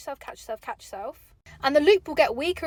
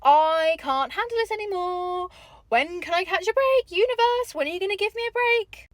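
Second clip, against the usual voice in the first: exasperated plea; 5 semitones higher than usual. Retold or imitated. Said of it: "where I've gone".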